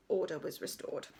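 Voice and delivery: ordinary delivery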